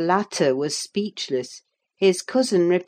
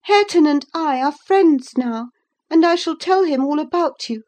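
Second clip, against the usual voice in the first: high, raised, daring voice; defiant young lady; 9 semitones higher, 6 dB louder than usual. A narrator's reading. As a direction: threaten him boldly, pitched high. Catherine threatens to tell.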